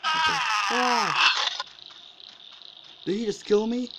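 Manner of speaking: high loud disbelieving yell; shocked shout. Real, not acted. Player killed suddenly by Bigfoot, shocked shout. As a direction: shriek in shock at dying.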